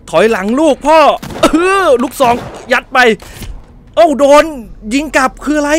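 high, loud battle panic; frantic yelling. Real, not acted. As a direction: shout orders mid-fight. Panicked firefight in the game. (ตะโกนตื่นเต้นกลางการยิง)

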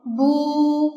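bu held long with rounded lips; long closed-lip vowel. Shown as a demonstration. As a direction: draw out the u in bu. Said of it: "长音阶合口".

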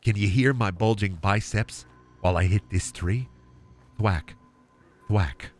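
low, soft murmuring lumberjack; ASMR lumberjack. Pretend. An ASMR lumberjack soothing a coworker while chopping wood.

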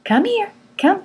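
high coaxing call to a dog; high, friendly dog call. Shown as a demonstration. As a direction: sing out come here to the dog, pitch up. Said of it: "If I say".

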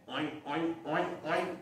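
soft oinking pig sounds; quietly oink like a pig. Acted. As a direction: piglets oinking softly. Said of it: "sound comes".

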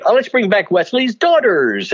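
high, sing-out stage announcement; host calling acts back. Retold or imitated. Headliner brings back the opening act, announcing. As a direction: call the dancers back out in a high voice. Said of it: "he would then say".

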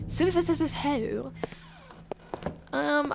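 ordinary delivery

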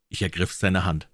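usual speaking voice

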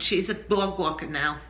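normal talking voice

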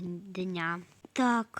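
ordinary talk, nothing marked

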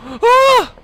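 sudden high shriek of fright; real jump-scare scream; startled scream. Real, not acted. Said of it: "I screamed like a girl".

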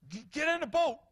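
high, loud urgent command; emphatic imperative. Acted. Preacher showing how Jesus ordered the disciples, emphatic imperative. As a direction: order them aboard with sudden force. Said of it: "It's an emphatic imperative. It's like".

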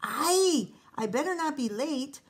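loud high exasperated scold. Acted. Rosa scolds the cookie as she returns, loud. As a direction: scold while turning back. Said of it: "Rosa scolded".

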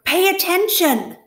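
a very high, loud scream. Acted. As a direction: shriek the order at the rat. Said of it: "screamed Wilbur".

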